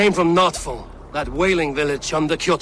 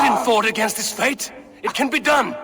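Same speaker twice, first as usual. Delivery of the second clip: fierce defiant yell; defiant shout; 8 semitones higher than usual. Acted. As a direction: shout it against fate. Defying fate with a shout.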